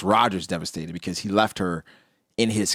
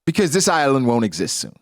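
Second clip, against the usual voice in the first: high, loud, smug warning; cold villain reveal; 7 semitones higher, 4 dB louder than usual. Retold or imitated. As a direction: sneer it out above his own voice. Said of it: "he's saying".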